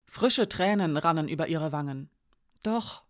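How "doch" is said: plain reading voice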